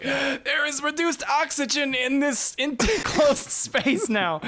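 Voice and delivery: high, strained, slow gasping speech; gasping for air. Acted. Fire-breather out of oxygen in enclosed space, gasping. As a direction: squeeze the words out short of air. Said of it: "take in a deep breath".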